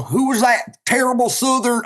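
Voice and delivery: lightly hammed cartoon Southern accent; a hint of cartoon Southern accent. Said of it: "like a cartoon Southern".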